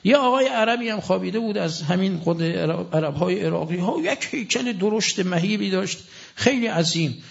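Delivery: ordinary talk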